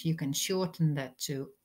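usual speaking voice

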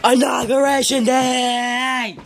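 slow, drawn-out high victory shout; triumphant inauguration yell, stretched out; celebratory shout. Real, not acted. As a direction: bellow it like a rally chant.

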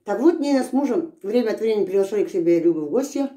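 words around chewed food; talking while chewing. Real, not acted. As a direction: mouth full of sausage. Seen 3: Puts sausage slice in mouth at 1.7, then chews while speaking.

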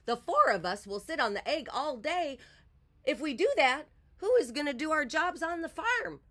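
high-pitched, indignant hen; storybook hen objecting in a high voice; squawking hen. A narrator's reading. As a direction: squawk your protest like a hen. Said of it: "squawked Martha".

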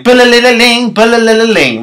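high sung ringtone; high mimicked ringtone; phone ring imitation. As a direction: sing the ringing phone.

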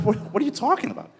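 high fast mock surprise; surprised. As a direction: react in surprise. Retold surprised reply.

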